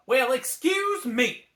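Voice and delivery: slow, high, loud huffy retort; offended dragon. A narrator's reading. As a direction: take offense theatrically. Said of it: "said Mushu".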